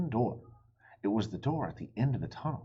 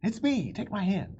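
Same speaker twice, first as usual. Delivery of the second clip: urgent call; 11 semitones higher, 6 dB louder than usual. Acted. Annie reassures startled Jack.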